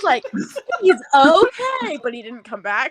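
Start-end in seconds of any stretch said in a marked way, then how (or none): 0.8-2.0 high, drawn-out announcer voice; hype man. Retold or imitated. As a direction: reassure the crowd, hype-man style. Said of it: "still in that hype man voice".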